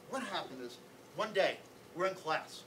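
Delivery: plain reading voice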